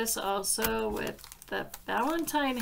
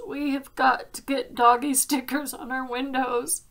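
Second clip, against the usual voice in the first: tearful plea; 3 semitones higher, 5 dB louder than usual. Real, not acted. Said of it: "I didn't mean to cry".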